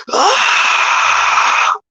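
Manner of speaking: loud high scream of pain; screaming in burning pain; pained cry. Retold or imitated. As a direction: cry out as if burned. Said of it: "and I just went".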